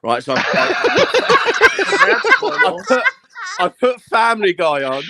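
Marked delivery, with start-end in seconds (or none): 0.8-2.4 shrieking high laugh; a high, helpless laugh at the memory; high squealing laugh. Real, not acted. Laughing mid-story. 2.5-5.0 laughing through words. Real, not acted. Laughing while telling.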